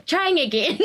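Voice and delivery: a high laugh breaking through the words. Real, not acted. Laughing as she sums up her life lesson. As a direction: end the point laughing.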